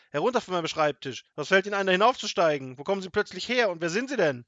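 loud, high, indignant tirade; outraged officer firing questions; scolding. A narrator's reading. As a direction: bawl out the intruder. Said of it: "schimpfte Wachmeister Dimpfelmoser".

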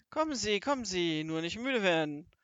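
loud, brisk call; loud cheery nagging; urging on. Acted. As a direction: chivvy a straggler along. Grandmother urges captive robber along.

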